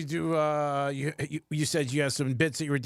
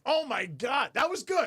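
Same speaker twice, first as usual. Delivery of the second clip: high, delighted exclamation; amazed reaction; 8 semitones higher, slower than usual. Retold or imitated. Host acting out a character stunned by the wife, voice jumping high. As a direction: gush about it in surprise. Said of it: "He's like".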